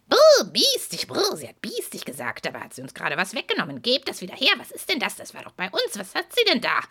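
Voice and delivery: grumbling, huffy horse voices; offended herd protesting; indignant horses. Acted.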